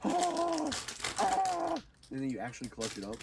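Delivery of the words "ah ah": much higher, squealing hype of watching players; high-pitched awestruck shrieks; excited fan squeal. Retold or imitated. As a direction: gush like a teen gamer watching the clutch. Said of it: "they were like".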